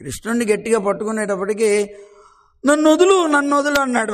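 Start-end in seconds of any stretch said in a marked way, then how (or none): 2.6-3.8 high, loud cry to be let go; pleading child. Acted. Caught child Krishna begs the gopika, loud pleading. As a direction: squeal it like a trapped little boy.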